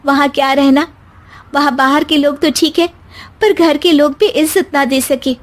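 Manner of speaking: put-on young bride defending herself; hurt young wife. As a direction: answer back with wounded pride. Anjali answers mother-in-law.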